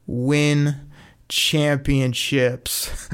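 win, then championships drawn out very slowly; slow emphatic payoff. Shown as a demonstration. Hyping up listeners, slow punchy win championships. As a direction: lean hard on win and stretch out championships. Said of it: "emphasize this quote".

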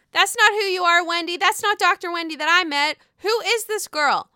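loud high-pitched indignation, calling Wendy out. Real, not acted. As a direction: shout the disbelief at her. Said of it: "ranting at Wendy".

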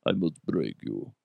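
deep, quiet Russian menace; Russian accent. Acted. Character repeats his threat after joking, low Russian accent. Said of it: "I don't have a good Russian accent".

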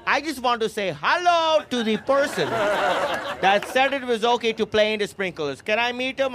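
high mock-polite accented mom; pissed mom. Mom asks to meet who allowed sprinklers, mock pissed. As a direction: feign sweetness while hunting the culprit. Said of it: "she just lands at a sidewalk pissed".

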